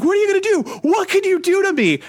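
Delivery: high-pitched mock shouting challenge; intense imitated rage. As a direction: play the admin frothing with indignation. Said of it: "it was intense".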